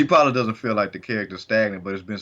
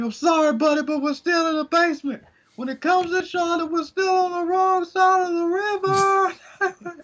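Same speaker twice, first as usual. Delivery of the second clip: mock falsetto. Joking to co-host about being stuck, mock falsetto. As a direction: squeal it out in a put-on high voice.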